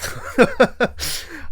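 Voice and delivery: breathy unvoiced laugh, pitched high; real burst of laughter. Real, not acted. Laughing at the scene.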